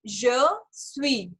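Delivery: je suis with silent final s, "sui"; French je suis. Shown as a demonstration. Teacher models je suis for the class, clearly. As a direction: say je suis as "zhuh swee", no s. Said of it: "इसकी pronunciation सीख लेते हैं".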